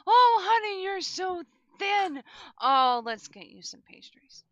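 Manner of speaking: put-on high, loud doting old baker; grandma voice. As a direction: fuss over a skinny kid, gushing. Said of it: "she goes".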